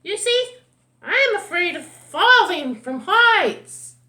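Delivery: high, loud cartoon bird; shrieky, forceful bird character. A narrator's reading. Bird explains its fear and remedy.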